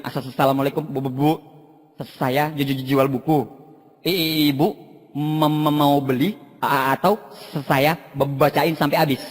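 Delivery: low, halting mimicked stammer; stuttering salesman. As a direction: stumble on each first sound. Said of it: "si Gagap".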